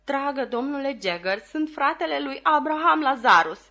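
high-pitched, pleading man; pleading petitioner. A narrator's reading. Petitioner appealing to a powerful lawyer, high and pleading.

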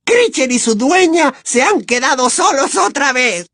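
high, gleeful elf exclamation; exclaiming elf. Acted. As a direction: crow it out like a spiteful servant. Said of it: "exclamó".

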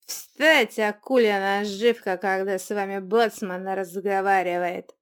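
loud barked boatswain command, fading; bellowing order. Acted. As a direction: roar it at the table. Drunk troll bellows at them.

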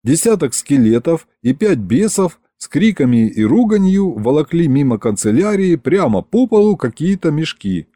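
plain reading voice

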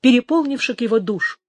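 usual speaking voice

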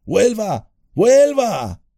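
high, rising urgent shouts; Sam calling down. A narrator's reading. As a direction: yell down into the dark. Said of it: "oyó la voz de Sam".

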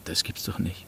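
plain reading voice

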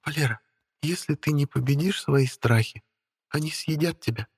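soft, high, earnest warning; quiet and serious. Acted. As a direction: say it very quietly, gravely. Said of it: "очень тихо и очень серьёзно". (очень тихо и серьёзно)

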